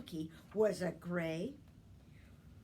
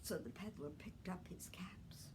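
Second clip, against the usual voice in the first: whispered; 3 semitones lower, 9 dB quieter than usual. A narrator's reading. Whispered narration.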